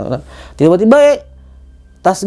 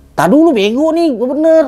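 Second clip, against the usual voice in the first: higher, irritated snap back; annoyed retort; 9 semitones higher than usual. Retold or imitated. Hiker snapping at teasing friend, higher irritated retort.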